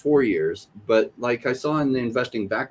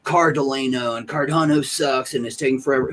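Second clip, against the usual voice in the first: mock whining; 4 semitones higher than usual. Quoting complainers about delays.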